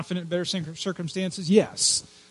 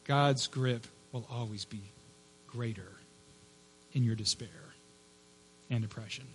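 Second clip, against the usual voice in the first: soft, quiet close; 4 semitones lower, 11 dB quieter than usual. Real, not acted. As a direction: drop to a soft, much quieter voice. Preacher softens after climax.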